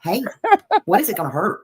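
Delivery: ordinary talk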